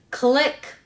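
ordinary delivery, nothing marked

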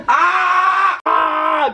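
yelp. Real, not acted. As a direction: cry out as she hits. Daughter attacking him.

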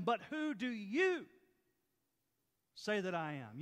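But who do YOU say that I am, YOU slow and punched; stress on you. Shown as a demonstration. Preacher reading the verse to his church, YOU stressed. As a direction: lean hard on YOU, slowly. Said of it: "You notice I read it that way".

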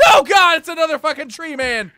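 loud, high alarmed yell; yelling at a surprise enemy; panicked shout. Real, not acted. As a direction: scream it in alarm. Attacked by tree man.

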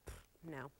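low, soft, snooty brush-off; mock dismissive scoff. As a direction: puff it away and refuse, imitating disdain. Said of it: "I threw my nose up at it".

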